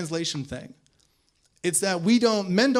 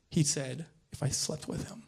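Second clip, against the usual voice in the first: ordinary talk, nothing marked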